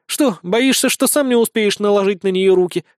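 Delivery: high, teasing mockery; smirking jab. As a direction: needle him with a smirk. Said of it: "усмехнулась Безос". (с усмешкой)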